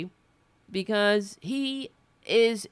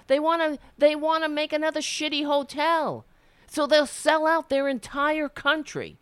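higher, louder outraged rant at the Trumps; indignant rant; 10 semitones higher, 4 dB louder than usual. Real, not acted. Radio host rails at the Trumps for selling out the country, outraged and louder. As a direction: spit out the contempt, voice climbing.

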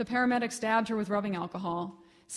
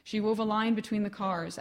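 usual speaking voice

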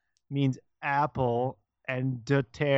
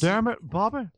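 higher-pitched cartoon dad impression; Hank Hill; 7 semitones higher than usual. Imitating a cartoon dad scolding his son, exasperated. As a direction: imitate an exasperated animated father. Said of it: "he used that voice".

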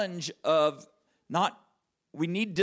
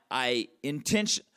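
normal talking voice

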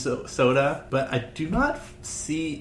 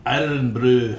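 bad Scottish accent; 4 dB louder, slower than usual. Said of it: "with my horrible accent".

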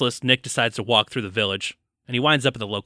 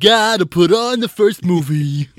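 put-on higher, louder, slow kid voice; imitating kid; 5 semitones higher, 7 dB louder than usual. Co-host joining in as the kid, high and slow mock child voice. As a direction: put on a whiny small-child voice. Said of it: "That's how my kid sounds".

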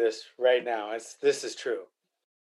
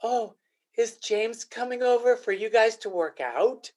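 mildly mock-sweet wife, a bit higher; condescending wife; 6 semitones higher than usual. Husband imitating wife belittling his workout buddy, mildly condescending. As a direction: lightly mimic her patronizing question. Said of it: "she goes".

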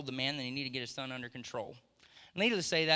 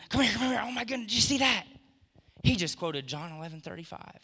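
high, loud, fast thrilled call to the angels; bursting with a father's excitement, calling others over; excited God; 7 semitones higher, 6 dB louder than usual. Retold or imitated. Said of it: "he gets all excited about it".

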